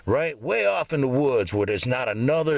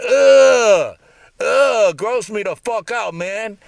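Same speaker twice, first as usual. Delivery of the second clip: loud, slow, higher ugh of disgust; disgusted groan; 7 semitones higher, 10 dB louder, much slower than usual. Real, not acted. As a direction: groan out the revulsion. Disgust at wife's cheating.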